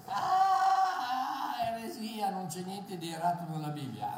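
high shouted cry of protest; mock a scandalised believer crying heresy; outraged objector. Preacher mimicking an indignant believer who cries heresy.

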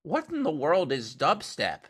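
high, slow, puzzled old-lady impression; imitated mom. Imitating his mom's voice memo, baffled mom. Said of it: "it's, like, his mom going".

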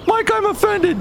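very high squeaky offended voice. Pretend. Host voices offended character.

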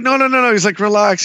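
calm the crowd, teasing and high; high excited reassurance. Retold or imitated. Director to cheering fans, high playful hushing.

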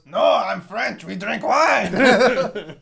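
lightly hammed French accent, slow on the last words; mock French accent. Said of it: "And he goes".

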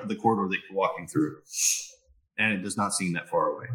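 usual speaking voice

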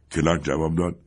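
usual speaking voice

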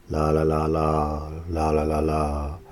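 low, droning monotone chant. Shown as a demonstration. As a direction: drone it softly on one unchanging note. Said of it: "talking in a monotone voice".

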